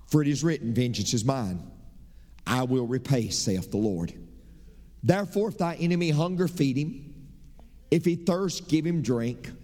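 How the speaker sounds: measured solemn scripture reading. Acted. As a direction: read the verse like a proclamation from the pulpit. Reads Romans aloud.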